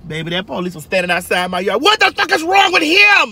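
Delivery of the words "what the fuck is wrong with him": high, loud angry shout; imitating a cop yelling about him.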